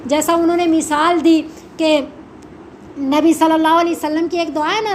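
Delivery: ordinary talk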